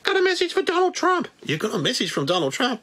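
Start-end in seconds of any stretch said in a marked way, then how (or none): 0.0-1.3 very high squeaky puppet bat; Wuhan bat voice. Pretend. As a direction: squeak out hellos like a tiny bat. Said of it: "The Wuhan bat".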